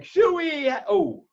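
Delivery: mock calling out. Calling out to cockroach picture.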